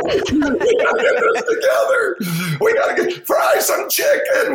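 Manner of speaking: very high, loud, frantic yelling; frenzied cook shouting over kitchen mayhem; manic kitchen shouting. Acted. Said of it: "so we're all manic".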